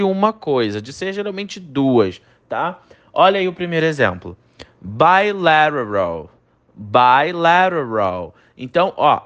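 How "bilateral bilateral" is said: slow bilateral with a tapped T; careful English pronunciation. Shown as a demonstration. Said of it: "a pronúncia dessa frase é um pouco complicada". (bilateral devagar, com o T soando como R)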